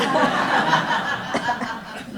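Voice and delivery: loud real laugh breaking out; hearty laugh mid-speech; burst of laughter. Real, not acted. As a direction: laugh out loud, caught by the memory. Laughing during memorial remarks.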